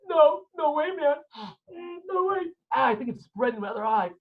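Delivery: high, tearful imitation of a tough man; hiding his tears. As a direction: squeak it out while faking that you're fine. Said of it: "the satisfaction that he's crying".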